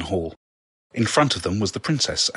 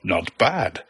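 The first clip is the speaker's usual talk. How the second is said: ordinary delivery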